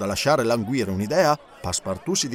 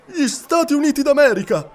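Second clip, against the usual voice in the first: much higher and louder, bursting with delight; excited exclamation; 12 semitones higher, 6 dB louder than usual. A narrator's reading. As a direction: cry it out with sudden joy. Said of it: "esclamò Passepartout".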